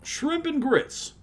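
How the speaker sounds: high loud ad-read announcer. Acted. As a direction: hype the menu like a radio ad. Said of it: "No, I was an announcer".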